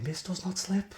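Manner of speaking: a half-hearted Scouse Gerrard impression; bad Gerrard accent. Mocking Gerrard's famous line in a bad Scouse accent. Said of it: "That was a very bad Gerrard accent".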